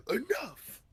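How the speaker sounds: high-pitched interrupting cry; Battle Beast. Retold or imitated. Fan acting out Battle Beast silencing chatty Conquest, high mock outburst. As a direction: cut the talker off in a put-on voice.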